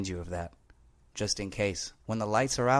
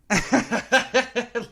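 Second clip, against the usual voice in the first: high, loud burst of laughter; 12 semitones higher, 7 dB louder than usual. Real, not acted. Host laughing at how much he talks, bursting out. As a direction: laugh at yourself, caught out. Laughs at his own chattiness.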